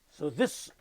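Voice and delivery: normal talking voice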